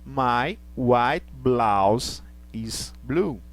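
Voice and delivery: words stretched slowly; slow model sentence. Shown as a demonstration. Teacher modelling the adjective form, slow. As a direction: read it slowly for the class. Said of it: "Toda vez que eu for utilizar".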